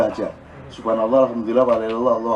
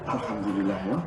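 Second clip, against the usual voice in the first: a soft, low murmured thanks; quiet thanks; 8 semitones lower, 11 dB quieter than usual. Retold or imitated. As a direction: mutter it under your breath, lightly pleased. Reaksi kecil dapat sejuta. (ucapan syukur pelan dan lirih)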